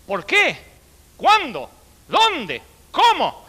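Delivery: high, sing-song questions, each falling; mock teacher quizzing the class; teacher's drill questions. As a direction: quiz them like a strict schoolmistress. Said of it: "y dijo".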